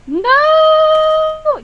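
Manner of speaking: high, loud wail of no; anguished shout. Acted. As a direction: scream no in despair. Players shouting no.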